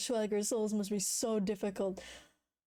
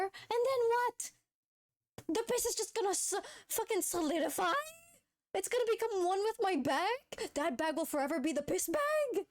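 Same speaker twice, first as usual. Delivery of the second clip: incredulous rant; 8 semitones higher than usual. Real, not acted. Incredulous rant about piss bag.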